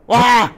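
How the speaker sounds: loud, high startled "wah"; short cry of fright; startled yelp. Real, not acted. Player startled by the character's return, loud yelp. Jump scare.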